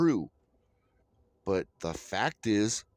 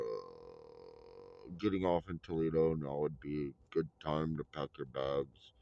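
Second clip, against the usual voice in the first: lower, droning conductor over the mic; train conductor announcement; 10 semitones lower, 5 dB quieter than usual. Retold or imitated. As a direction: drone it out like a bored conductor on the PA. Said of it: "come on the fucking mic every fucking stop and go".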